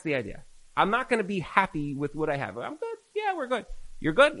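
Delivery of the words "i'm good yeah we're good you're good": mock content, much higher; high, self-satisfied mock voice; smug complacency. Rabbi mocking complacent spouses, high and smug.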